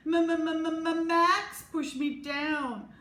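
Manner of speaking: high, slow, stammering sob; stuttering sob. A narrator's reading. Chloe accuses Max to the teacher, stammering high sob. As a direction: blubber out the accusation. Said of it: "Chloe sobbed".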